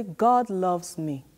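"God loves me" said very slowly, each word weighted; slow, clear call for the kids to repeat; slow demonstration. Shown as a demonstration. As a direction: model it slowly for the children. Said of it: "I want you to do it in this demonstration".

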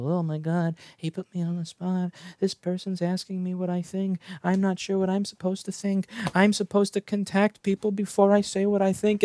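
soft, high, fretful inner voice; mock panic. Burrito maker put on the spot by his question, mock panicked.

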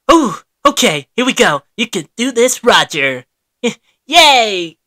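ordinary delivery

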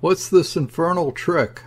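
high-pitched, indignant hail; furious yell. A narrator's reading. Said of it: "I yelled in a fury".

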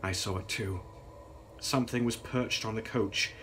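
usual speaking voice

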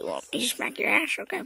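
usual speaking voice

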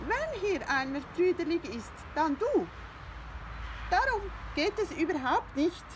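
high, loud, indignant hen; huffy hen Brillan. Acted. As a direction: put the cat in his place. Hen Brillan scolds Findus.

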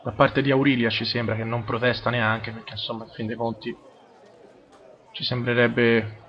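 normal talking voice

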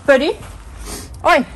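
loud firm commands to the dog; calling the dog. Real, not acted. Owner loudly ordering her bulldog to come out.

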